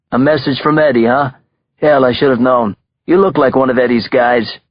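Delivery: mock the messenger like a big-shot gangster; amused mobster. Mobster amused, mocking the hitman.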